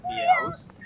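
very high squeaky little Meows; a mouse's high squeak of a name. Pretend. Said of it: "Please say it like that the rest of the time".

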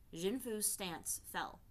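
usual speaking voice

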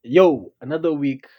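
ordinary delivery

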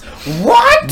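high, loud shocked "what"; mock disbelief. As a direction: yelp it in mock outrage. Mock reaction to women having rights.

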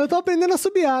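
high cartoon pig voice; squeaky little cartoon girl; Peppa voice. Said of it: "tipo a Peppa quando não sabe assobiar". (voz aguda da Peppa)

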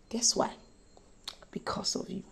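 breathy whisper, leaning in close. Acted. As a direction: whisper it to each listener as a secret. Hushed preaching climax.

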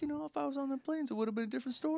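high, soft mocking voice; mock boastful quote. Comic imitating Mark Wahlberg's nine eleven boast, high mock voice. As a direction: put on a high little voice for the boast.

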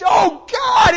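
loud, high cry of anguish to God. Retold or imitated. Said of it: "picture weeping in anguish".

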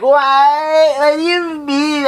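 high, slow, teary mock whine; whiny girls. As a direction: stretch it out like a girl about to cry. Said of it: "the girls are always like".

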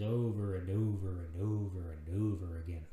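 each "over" stressed in a low, looping drone; weary repetition. Real, not acted. Parent tired of rereading the same book, droning "over and over". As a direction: drag out "over and over and over" in a low singsong.

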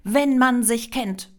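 loud, slow, pointed jab; high, deliberate angry dig; hurled retort. A narrator's reading. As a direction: land the insinuation hard. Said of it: "schleuderte Maria ihr entgegen".

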